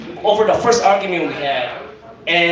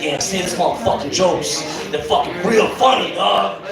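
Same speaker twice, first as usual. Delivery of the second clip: normal talking voice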